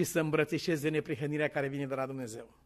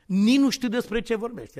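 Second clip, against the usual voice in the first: raised, louder mock denial; indignant denial; 5 semitones higher, 6 dB louder than usual. Peter brushing off the servant woman, indignant denial. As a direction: act out a firm denial of knowing anything. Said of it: "S-a jurat de trei ori".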